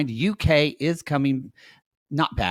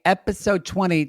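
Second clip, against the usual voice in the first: ordinary delivery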